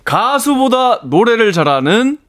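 high, slow acrostic line, drawn out; acrostic recital. Pretend. Guest answering the host's acrostic prompt, high drawn-out recital. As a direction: announce the line high and deliberately.

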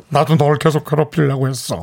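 low voice-changed drama line; disguised deep voice. Acted. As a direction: play it like a disguised drama villain. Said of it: "음성 변조".

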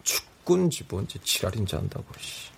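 fading tired grumble; too sleepy to finish; sleep deprived. Acted. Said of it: "졸음이 쏟아지는 상태".

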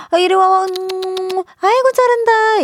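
high, drawn-out coaxing of a pet; dog groomer coaxing. Acted. Groomer sister talking to people like dogs, high-pitched coaxing. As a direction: imitate a groomer cooing praise to a dog. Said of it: "수시로 혀를 차며".

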